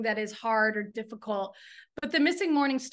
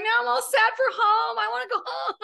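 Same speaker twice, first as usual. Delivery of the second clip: much higher, laughing through a mock sob; teary, half-laughing whimper, louder; mock tearful whine; 11 semitones higher, 5 dB louder than usual. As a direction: wail playfully about missing home. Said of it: "You're making me tear up too".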